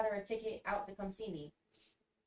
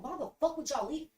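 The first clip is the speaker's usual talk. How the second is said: higher-pitched, indignant demand; retold pissed-off question; 7 semitones higher than usual. Abandoned outside the club by the group, pissed off. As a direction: replay the outrage without shouting. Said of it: "I'm pissed".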